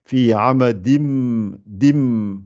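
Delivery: slow fi amadim dim, drawing out the doubled m; showing the shadda; doubled mim. Shown as a demonstration. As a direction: stretch the mim out slowly. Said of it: "je double cette lettre-là".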